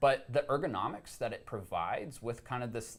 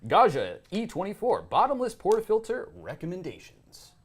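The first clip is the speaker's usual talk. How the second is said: big announcer read, raised and louder; newscaster voice; 8 semitones higher, 8 dB louder than usual. Pretend. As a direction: read the headline like a TV anchor. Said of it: "I want your newscaster voice".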